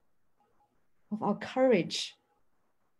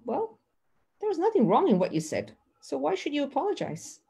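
a mild imitation of a dismissive reply, quick; lightly brushing off the complaint, quicker; 6 semitones higher than usual. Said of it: "But you feel".

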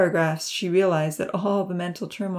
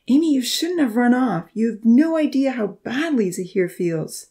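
loud, higher scolding; chiding; 6 semitones higher, 5 dB louder than usual. A narrator's reading. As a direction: scold her firmly. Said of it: "But then he chided".

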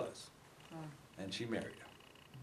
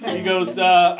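usual speaking voice

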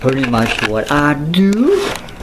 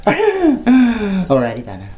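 comic sign-off; 6 semitones higher, much slower than usual. Acted.

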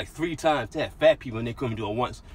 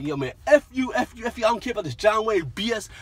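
blowing up; 7 semitones higher than usual. Retold or imitated. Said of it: "I just blew up".

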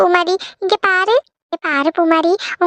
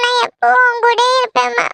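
high, sobbing daughter's voice; acted weeping, pitch lifted; crying; 4 semitones higher than usual. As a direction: cry as you promise your mother. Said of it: "அழாத மக்களே".

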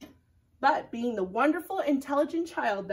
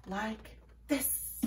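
ordinary delivery, nothing marked

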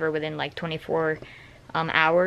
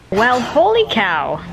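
surprised; 5 semitones higher, 5 dB louder, slower than usual. Real, not acted. Surprised exclamation on returning.

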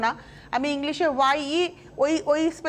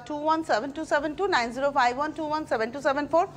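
rattled off; much faster than usual. Real, not acted. Rattling off booking number.

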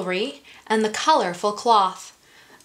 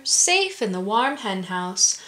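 usual speaking voice

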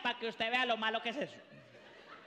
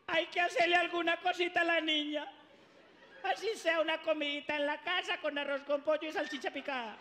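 higher, insistent mom voice; pleading mother; 5 semitones higher than usual. Acted. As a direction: coax the dad like a mother. Mother pleading for daughter's party.

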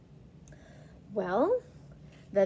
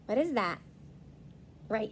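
usual speaking voice

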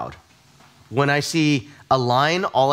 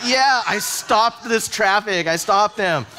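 gloating triumph; 6 semitones higher, slower than usual. Retold or imitated. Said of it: "And I'm like".

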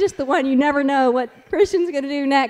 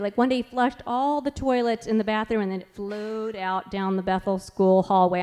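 ordinary talk, nothing marked

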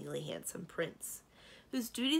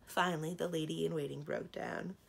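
usual speaking voice

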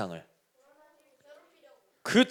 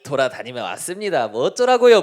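snide sarcasm; 3 semitones higher than usual. Acted. Satan answering God back, in a snide, sarcastic tone. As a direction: act cheeky and obnoxious, stretching the words. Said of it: "비아냥거리는 말투로, 아주 얄미운 말투로".

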